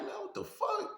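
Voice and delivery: sudden high startled yelp; shocked outburst. Retold or imitated. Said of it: "I'm like".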